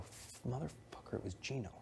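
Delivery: cursing under his breath; muttered curse. Retold or imitated. Retold realization, cursing under breath.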